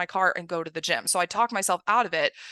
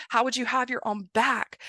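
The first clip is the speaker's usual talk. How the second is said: ordinary talk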